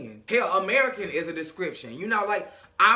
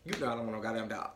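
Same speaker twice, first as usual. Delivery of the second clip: much lower, softer, fast grumble; low quick refusal; 11 semitones lower, 11 dB quieter, much faster than usual. Retold or imitated. Amara refusing dog.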